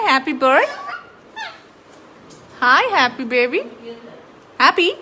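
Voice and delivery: loud high call to the bird; excited bird greeting. Real, not acted. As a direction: sing out his name brightly.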